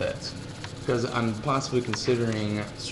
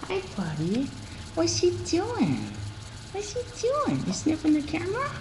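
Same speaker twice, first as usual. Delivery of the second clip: very high, softer pet-talk voice; 4 dB quieter than usual. Real, not acted. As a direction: coo at the dog, much higher. Baby-talking to the dog.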